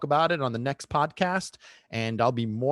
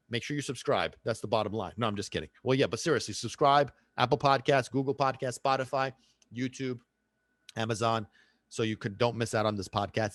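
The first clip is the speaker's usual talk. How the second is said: ordinary talk, nothing marked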